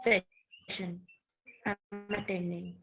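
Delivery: choppy call audio; dropping out on a weak line; conference call. Real, not acted. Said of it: "your audio is cutting out sometimes".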